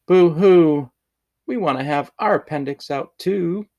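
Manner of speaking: little girls fake-crying to get an appendix out too; mock wailing girls. A narrator's reading. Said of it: "all the little girls cried".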